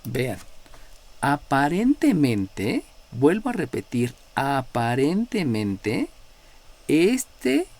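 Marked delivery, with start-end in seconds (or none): 1.2-2.8 "aparentemente" stretched out slowly, ending on a rise; drawn-out emphasis. Real, not acted. Teacher warning students about appearances, drawn-out emphasis. 4.3-6.1 "aparentemente" very slow, each part lengthened, rising at the end; drawn-out emphasis. Real, not acted. Teacher repeating a caveat to students, drawn-out emphasis.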